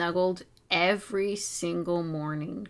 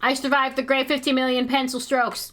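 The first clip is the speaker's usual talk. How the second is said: higher and louder, relieved victory; real relief at finishing the pencil strokes; triumphant; 6 semitones higher, 6 dB louder than usual. Real, not acted. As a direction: crow that you made it through. Finishing the long drawing.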